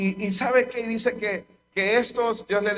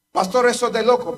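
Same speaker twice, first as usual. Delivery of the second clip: raised, higher objection to the pastor; loud incredulous congregant; scoffing churchgoer; 4 semitones higher, 6 dB louder than usual. Acted. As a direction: protest that this is madness.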